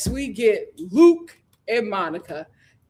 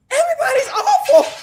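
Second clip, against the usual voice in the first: laughing exasperation; 13 semitones higher, 7 dB louder, faster than usual. Real, not acted.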